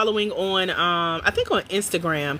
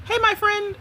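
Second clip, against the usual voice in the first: much higher, sing-song friendly greeting; TikTok coffee girl; 12 semitones higher than usual. Retold or imitated. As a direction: chirp it like a bubbly barista. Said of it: "That's how she talks".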